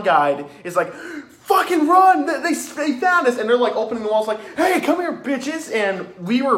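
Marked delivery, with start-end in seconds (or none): 0.9-3.4 high-pitched, urgent acted warning; mock panic. Clown guide to hiding group when found, mock panic. 4.6-5.7 high-pitched mock taunt; taunting clown. Killer clown to hiding group, high taunting call.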